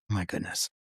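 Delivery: low, soft groaning oh my goodness; mock despair. Patient resigned after the broken needle, low and soft. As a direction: sink into it, defeated.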